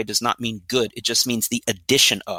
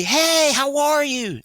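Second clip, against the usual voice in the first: much higher, hammed-up sing-song hello; bright fake greeting; 13 semitones higher, much slower than usual. Trainer playing a visitor at the door, chirpy high greeting. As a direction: greet the guest in a put-on cheery voice. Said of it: "And I'm gonna say".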